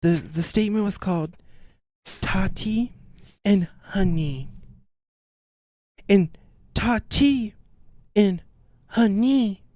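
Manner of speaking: halting, self-important quote; mock solemn speaker. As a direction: intone the foreign saying like profound wisdom. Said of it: "she closes her eyes too".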